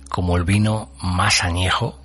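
ordinary talk, nothing marked

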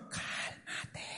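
slow breath of a whisper; drawn-out calming whisper; hushed whisper. Acted. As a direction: whisper it to settle them down. Hushing those eager to marry.

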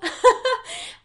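loud high burst of laughter; hearty high laugh. Real, not acted. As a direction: break into laughter mid-sentence. Laughing mid plea.

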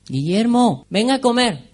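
put-on high, loud call to a child; parent calling out. Parent calling gaming child to dinner, loud singsong call. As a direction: call them in to eat from another room.

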